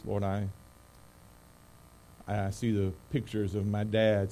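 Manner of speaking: soft, earnest pastoral prayer. Real, not acted. Pastor praying for the church before the sermon, soft and earnest. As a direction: pray quietly and sincerely, with long pauses.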